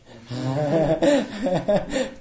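long, hearty laugh at his own punchline; real, loud laugh. Real, not acted. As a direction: burst out laughing.

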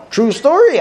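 ordinary talk

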